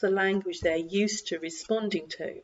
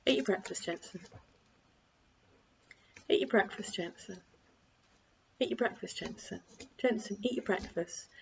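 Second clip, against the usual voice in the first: soft, even, unhurried repetition; calm broken record; 7 dB quieter than usual. Shown as a demonstration. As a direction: repeat it calmly with no rise at all. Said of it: "Keep it like a broken record".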